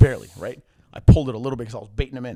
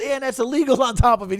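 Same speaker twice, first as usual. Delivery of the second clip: indignant friend; 10 semitones higher, 6 dB louder than usual. Retold or imitated. Friend yelling at the ref.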